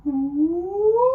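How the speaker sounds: smooth and beautiful, no squeak; high gliding ooh; Baby Owl ooh. Shown as a demonstration. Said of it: "Let's do it all together".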